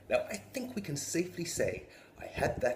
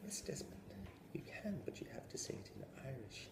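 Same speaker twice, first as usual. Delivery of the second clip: whispered cold teacher; hissed teacher; 3 semitones higher, 15 dB quieter than usual. Retold or imitated. Teacher coldly insisting to the boy, whispering. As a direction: hiss it with quiet menace.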